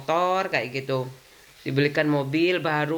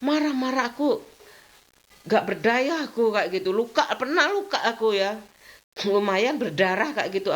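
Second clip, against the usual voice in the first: ordinary delivery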